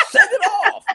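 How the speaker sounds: high, loud exasperated yell; retold fed-up yell. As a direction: yell it like you're done with it. Retold upset order to send tape.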